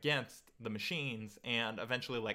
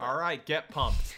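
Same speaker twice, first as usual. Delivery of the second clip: hyped up; 3 semitones higher, 8 dB louder, slower than usual. Real, not acted. Host hyping guest before watching.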